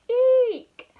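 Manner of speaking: very high, loud squeal; cartoon shriek of alarm; startled eek. A narrator's reading. Elephant as Cheetah swings into it, shrieked eek.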